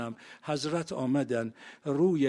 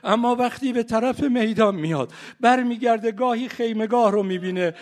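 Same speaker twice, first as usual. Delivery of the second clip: higher, louder wailing rowzeh recital; keen the martyrdom story to the mourners; sermon rising into a loud grieving chant; mourning lament; 6 semitones higher, 8 dB louder than usual. Acted.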